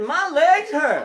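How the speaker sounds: whiny friend. Retold or imitated. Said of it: "Lee said".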